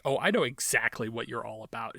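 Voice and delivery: ordinary talk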